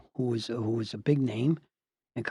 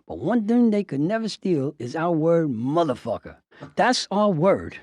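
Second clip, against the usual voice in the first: Southern Baptist preacher; 2 semitones higher, 3 dB louder than usual. Retold or imitated. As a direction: retell a preacher's riff, stretching each phrase. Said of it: "like a Southern Baptist preacher".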